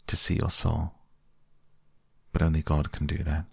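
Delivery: mildly sorrowful, gravely spoken; understated grief, said gravely. A narrator's reading. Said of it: "answered Hallward gravely".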